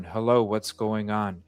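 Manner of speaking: usual speaking voice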